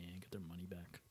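soft whispered aside; whispering. Real, not acted. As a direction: murmur it to yourself. Quiet aside.

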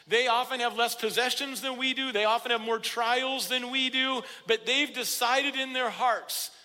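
ringing, emphatic sermon delivery; impassioned preaching. Real, not acted. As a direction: press each point home with conviction. Preacher builds with conviction.

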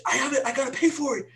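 high-pitched frantic insistence, played back; retold frantic excitement. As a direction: relive the panic in a high voice. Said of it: "I was screaming".

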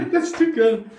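high laughter through words; laughing hard. Real, not acted. Laughing at story.

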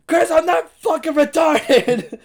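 high, loud, slow mom snapping back; hammed-up furious mother; mock angry mom. Mom snapping at her son caught with his girlfriend. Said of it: "She goes".

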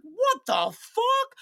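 high, slow, drawn-out disbelief; incredulous outburst. Retold or imitated. Retells shocked reply to friend.

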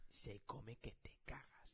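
slow, hushed whisper. Real, not acted. Telling friends how well the north eats, whispered. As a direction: let them in on a secret. Confiding about northern food.

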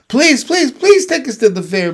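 high, loud little gosling pleading; begging gosling. A narrator's reading. Gosling begs mother to go to the fair, pleading. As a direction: beg in a high, excited baby-bird voice. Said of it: "begged the gosling".

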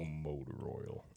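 low, slow, soft morning voice; deep morning voice. Retold or imitated. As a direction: drag it out low and groggy. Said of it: "the deep voice one, my morning voice one".

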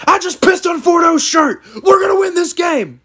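high-pitched imitation of coach's battle cry; rally the team like a hollering coach; retold rallying shout. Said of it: "He said".

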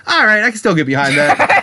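amused acceptance. Retold or imitated. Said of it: "I was like".

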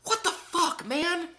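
very high squealing disbelief; shrieking disbelief. Real, not acted.

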